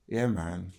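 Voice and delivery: ordinary talk, nothing marked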